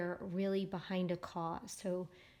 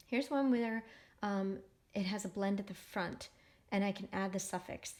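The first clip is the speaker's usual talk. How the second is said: normal talking voice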